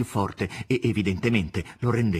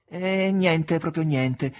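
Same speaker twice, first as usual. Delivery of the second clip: false reassurance; 8 semitones higher, 4 dB louder, much slower than usual. Acted. The nurse hides the neighbour's death from Ivan, falsely cheerful. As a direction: brightly fake denial. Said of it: "Ma la sua voce era falsa".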